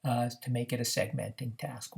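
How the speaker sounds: ordinary delivery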